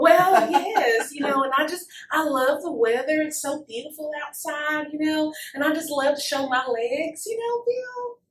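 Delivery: playful Southern belle character; Southern accent. Acted. Charming the interviewer in character, Southern accent. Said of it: "with this Southern accent".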